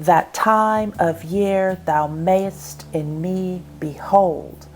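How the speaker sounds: slow iambic beat, "That time of year thou mayest in me behold", leaning on time, year, may, me, hold; exaggerated iambic stress. Shown as a demonstration. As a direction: swallow the first syllable of each foot in "That time of year thou mayest in me behold" and draw out the second. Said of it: "the second syllable is emphasized".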